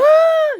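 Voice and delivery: very high loud scream. Acted. Wife screaming as car nears cliff, high shriek. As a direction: let out a terrified shriek. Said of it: "She's like".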